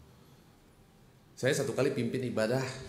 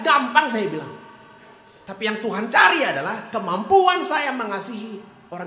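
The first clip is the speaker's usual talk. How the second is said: loud, high fiery sermon voice; preaching at full force; 14 semitones higher, 10 dB louder than usual. Acted. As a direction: thunder it to the congregation.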